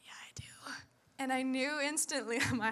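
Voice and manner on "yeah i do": whispered yeah I do; guilty whisper. Retold or imitated. Admitting to God she knows, guilty whisper. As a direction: whisper the confession.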